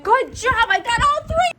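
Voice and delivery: high excited pet praise; talking to a dog. Real, not acted. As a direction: cheer the dogs on. Praising dogs.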